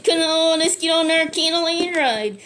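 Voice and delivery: high, loud come-on-let's-go call; eager rallying call. Acted. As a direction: rouse the party to set off. Guy urging them to ride.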